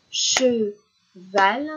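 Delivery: "cheval" in two separate beats, che, val; stretched, loud "che", pause, then "val"; split into syllables. Shown as a demonstration. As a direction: break "cheval" apart to count it. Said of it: "combien de syllabes".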